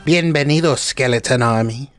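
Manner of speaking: usual speaking voice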